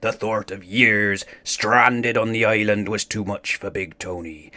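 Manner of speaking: usual speaking voice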